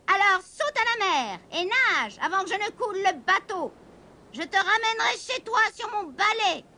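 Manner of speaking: a hint of a stern witch; witch commanding. A narrator's reading. The witch orders the kitten to jump, mildly stern.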